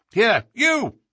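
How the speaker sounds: high, loud call across the glade; sharp shout. A narrator's reading. Holmes shouting to a frightened groom at the glade's edge, loud and commanding. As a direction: bark it out to summon him. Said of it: "he shouted to a frightened groom".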